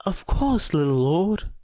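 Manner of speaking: a huge brute fawning on his little master; happy giant. A narrator's reading. Gigantomachia's devoted reply.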